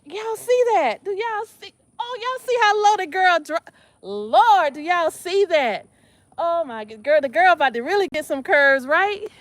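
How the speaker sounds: high and loud, bragging; thrilled showing off. Real, not acted. Thrilled at her low squat.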